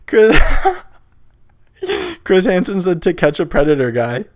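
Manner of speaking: helpless real laughter around the words; laughing. Real, not acted. Podcaster realizing they named the wrong Chris, laughing hard. Cracks up at own mistake.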